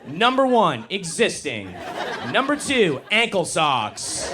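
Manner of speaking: mock a perky dating-advice influencer; lilting social media girl reading a list. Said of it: "beautiful women with their phones saying things like".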